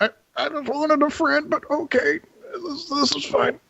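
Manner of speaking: a hurt little bird grumbling to itself; sulking pigeon. Acted. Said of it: "he's gonna kinda mutter to himself".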